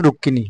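রুক্মিণী as "rukkini", no m; Bengali pronunciation. Shown as a demonstration. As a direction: double the k in রুক্মিণী and drop m. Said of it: "আমরা বাংলা ভাষায় বললে". (রুক্মিণী বাংলা ধরনে, "রুক্কিনি")

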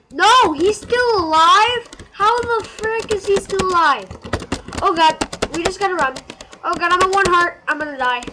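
high, loud frantic alarm; panicked yelling. Real, not acted. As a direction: shout in panic at one heart. Near death in game.

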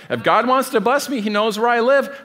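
sarcastic imitation of a lazy believer; mocking quote. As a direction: parody the excuse, sing-song and dismissive. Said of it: "stupidest statements like that".

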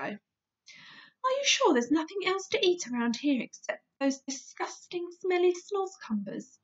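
lightly voiced Sophie, pleading. Sophie's voice in read story.

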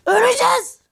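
high, loud cry of dread; panicked yell. Real, not acted. Gamer yelling to teammates about dying, panicked yell. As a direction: yell it like doom is coming. Shouting they will die.

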